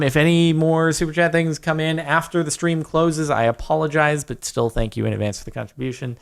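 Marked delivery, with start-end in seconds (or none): none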